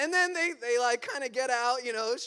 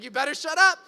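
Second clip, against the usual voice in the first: higher, much louder mock shout; retold angry yell; 5 semitones higher, 11 dB louder, faster than usual. Uncle at breaking point with a stranger, mock shout. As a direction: burst out with the warning, punching the end. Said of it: "Guy keeps screaming at him".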